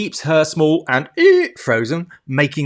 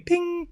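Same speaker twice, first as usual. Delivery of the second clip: lift bell ping; 4 dB quieter than usual. Pretend.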